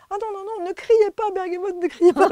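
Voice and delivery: imitating Mireille, high and loud, scolding. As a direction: hush the pupil in a high, shocked voice. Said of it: "j'ai dit à Mireille".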